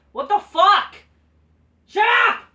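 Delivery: high, loud, fed-up yell; angry shout. Real, not acted. Fan yelling at WWE booking, high angry shout. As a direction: explode at it.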